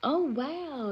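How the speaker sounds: high little oh wow; pleasantly surprised. Real, not acted. Streamer surprised by a viewer's comment, high oh wow. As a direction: let the surprise pop up.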